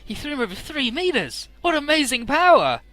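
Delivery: amazed commentary. Real, not acted. Speaker reacting to fight.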